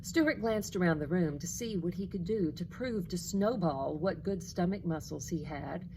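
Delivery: ordinary delivery, nothing marked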